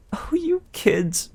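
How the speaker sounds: high, slow breathy-free whispered gush. Acted. Aphrodite gushing over Percy, high slow whisper. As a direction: whisper it adoringly.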